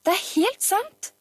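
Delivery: high, slow emphatic insistence; drawn-out high-pitched protest; children's insistent shout. A narrator's reading. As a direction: insist every word is true. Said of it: "ropte Hansen-barna i munnen på hverandre".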